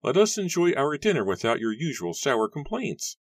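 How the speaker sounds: high-pitched elder brother; instructing. A narrator's reading. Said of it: "Matthew instructed".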